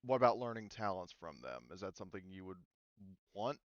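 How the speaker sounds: ordinary talk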